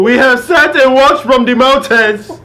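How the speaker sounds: slow, grand mock African accent; booming African accent, drawn out. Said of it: "It's the accent".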